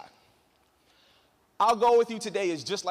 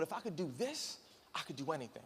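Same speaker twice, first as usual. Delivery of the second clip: much softer, inward resolve; quiet self-talk; 4 semitones lower, 13 dB quieter than usual. Retold or imitated. Speaker on the ledge, soft determined self-talk. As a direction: talk yourself into it under your breath.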